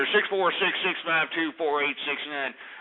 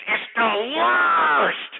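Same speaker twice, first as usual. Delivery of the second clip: higher, louder and very slow; exasperated outburst; 9 semitones higher, 4 dB louder, much slower than usual. Acted. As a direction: drag it out in disgust. Host rant climax.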